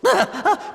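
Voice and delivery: short high amused chuckle; wry laugh. Acted. Papalymo shrugs off Ida's apology, wry laugh. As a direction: laugh it off fondly.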